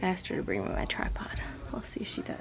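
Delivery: soft whispered aside; whispering. Real, not acted. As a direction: whisper it to the camera.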